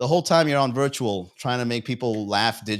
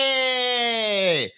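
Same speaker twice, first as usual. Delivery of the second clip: excited hey; 9 semitones higher than usual. Pretend. Viewer greets stream notification.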